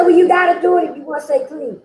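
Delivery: insistent counselor. Retold or imitated. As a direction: voice the counselor pressing the point. Voices counselor insisting on meetings.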